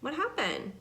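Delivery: offhand imitation with a lifted pitch; mock casual. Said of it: "so casual".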